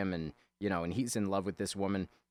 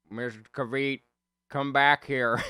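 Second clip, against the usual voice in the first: lightly voiced troubled character; acted movie quote; 4 semitones higher, 5 dB louder than usual. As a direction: call after her, a bit in character. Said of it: "He goes".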